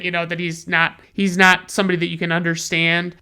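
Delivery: ordinary talk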